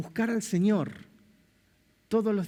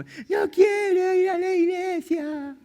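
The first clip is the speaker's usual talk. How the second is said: put-on much higher, louder, slow childish whine; whiny child; 12 semitones higher, 5 dB louder, slower than usual. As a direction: whine like a kid dragged to church. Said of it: "yo fui llorando". (niño quejoso, mucho más agudo, fuerte y lento)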